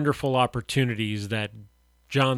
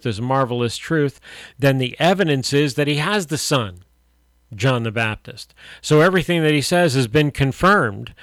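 rising, louder preaching build; 3 semitones higher, 6 dB louder than usual. Acted. Radio pastor preaching to listeners, louder and fervent. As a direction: drive the sermon home with fervour.

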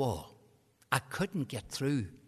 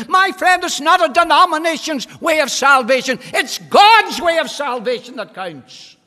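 much louder and higher, slow hammering sermon; fiery preaching; 12 semitones higher, 20 dB louder, slower than usual. Real, not acted. Preacher pounding the congregation with God's way, full force. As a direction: thunder out the gospel to the hall.

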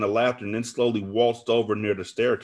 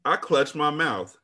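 ordinary delivery, nothing marked

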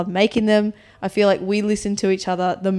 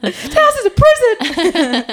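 much higher, slow, laughing; dramatic laughing wail; 12 semitones higher, 6 dB louder, slower than usual. Acted. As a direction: ham up the despair. Dramatic complaint.

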